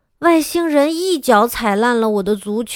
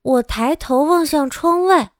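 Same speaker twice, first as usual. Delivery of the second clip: usual speaking voice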